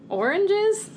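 Oranges with a high rising question. Real, not acted. Player offers a doubtful baby food answer, questioning. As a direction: lift "Oranges" right up, unsure of the answer. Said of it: "Also with a question mark".

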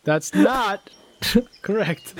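slow words broken by laughter; laughing. Real, not acted. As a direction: laugh through it. Laughing while speaking.

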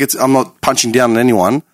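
ordinary talk, nothing marked

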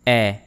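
E said as open "eh", as in bet; French open è. Shown as a demonstration. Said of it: "It is pronounced like this".

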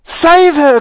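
loud, very high plea; desperate cry. Acted. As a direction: beg for help in motherly panic. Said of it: "cried Missus Beauregard".

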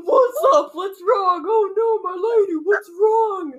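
high, loud, hammed-up alarm; comic guardsman rushing to rescue his lady; silly gallant voice. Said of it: "I don't know why I made him talk like".

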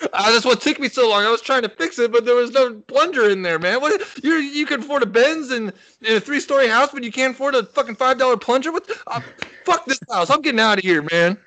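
high, incredulous rant; mock indignant rant. Ranting about the hosts with no plunger, high and indignant. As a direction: act outraged at the rich hosts.

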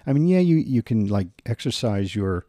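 usual speaking voice

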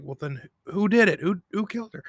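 high-pitched mock interrogation; retold demanding question. Billy retold demanding who killed her.

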